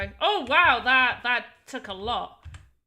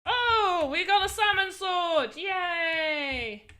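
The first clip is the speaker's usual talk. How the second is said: playful celebration; 4 semitones higher, much slower than usual. Pretend. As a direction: cheer like you found treasure. Celebrating a game item found.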